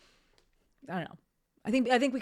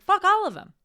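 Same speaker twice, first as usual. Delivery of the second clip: emphatic outburst; 12 semitones higher, 10 dB louder than usual. Pretend. Blunt reply to imagined critic.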